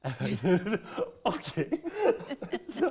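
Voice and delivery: laughing through the words; can't hold back the laugh while retelling. Real, not acted.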